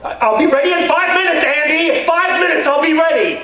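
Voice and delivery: loud high yell, stalling; impatient yell. Retold or imitated. Man yelling to the mailman from inside, loud high impatient shout. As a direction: yell back from inside the house, stalling for time.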